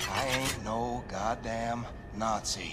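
each word spelled out slowly; slow, pointed repeat; slow and deliberate. Acted. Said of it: "Let me say this again".

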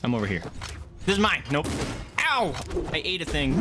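normal talking voice

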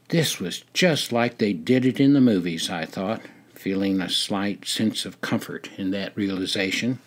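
ordinary delivery, nothing marked

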